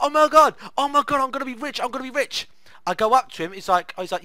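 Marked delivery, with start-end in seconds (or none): 0.0-2.5 high, loud giddy chant; giddy excitement. Retold or imitated. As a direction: gush about getting rich. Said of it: "So I was like".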